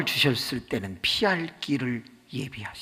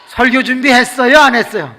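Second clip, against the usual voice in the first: mock scolding; 8 semitones higher, 11 dB louder than usual. Deacon scolds sleeping pastor.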